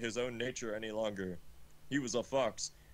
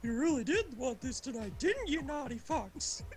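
hammed-up seducer; 14 semitones higher than usual. Shown as a demonstration. Reader redoing Ray's line to the fox, high camp purr. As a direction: redo it as a leering wolf, much higher. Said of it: "Wait, no".

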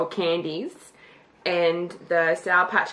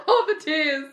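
laughing; 7 semitones higher than usual. Real, not acted. Laughing with teary eyes.

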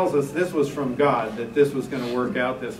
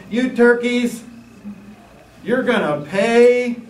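higher, louder, slower mock scolding; mock threat; 8 semitones higher, 6 dB louder, slower than usual. Preacher voicing Rehoboam threatening the people, mock angry. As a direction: ham up a king's threat to the crowd. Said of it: "Would you tell them".

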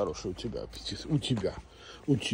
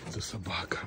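whispered aside; hushed, unvoiced aside; whispering; 6 semitones lower, 6 dB quieter than usual. Real, not acted.

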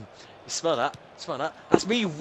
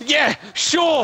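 loud, high, shouted sarcasm; raised-voice fake agreement; sarcastic; 8 semitones higher, 6 dB louder than usual. Real, not acted. Losing gamer mocks the idea of a FIFA game, sarcastic.

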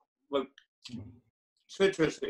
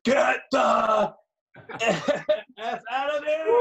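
yelling; 7 semitones higher, 6 dB louder, much slower than usual. Retold or imitated. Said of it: "She was like".

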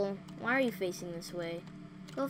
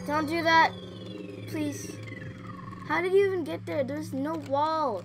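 shaken pleading; 6 semitones higher, 5 dB louder than usual. Real, not acted. Pleading after jump scare.

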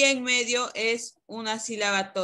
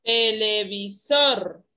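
"Televisor" slow and loud, syllable by syllable; stressed syllable demonstration; 5 dB louder, much slower than usual. Shown as a demonstration. Teacher correcting Rodrigo's answer, "Televisor" slowly sounded out with strong "sor". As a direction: break up "Televisor", punching the final "sor". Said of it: "¿Cuál es la sílaba tónica?".

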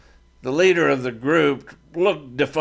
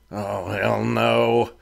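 ordinary delivery, nothing marked

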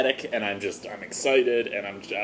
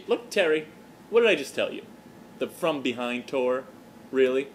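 usual speaking voice

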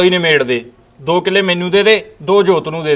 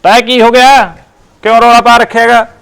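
calling out; 4 semitones higher, 4 dB louder than usual. Acted. Son bursts in on quarrel.

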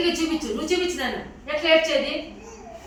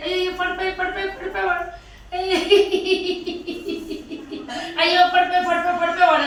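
mocking laugh; 4 semitones higher than usual. Shown as a demonstration. Teacher showing kids how they laugh at a fallen friend, mocking. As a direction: tease a kid who just fell over. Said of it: "అని నవ్వేది".

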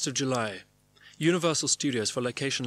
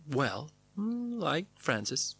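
higher, hesitant musing with a hum; echo of Diane; 3 semitones higher, much slower than usual. Acted. Narrator describing Coppola with Diane's phrase, musing and halting. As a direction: echo her vague, halting delivery.

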